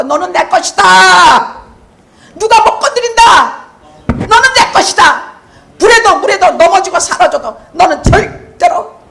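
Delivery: booming divine promise from the pulpit; God's voice proclaimed. Acted.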